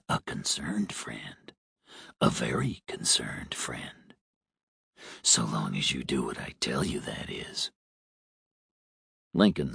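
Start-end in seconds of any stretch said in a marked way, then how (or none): 0.1-7.7 low hoarse smoker's whisper. A narrator's reading. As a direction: threaten in a rasping whisper. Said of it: "The man sounded hoarse, like a heavy smoker".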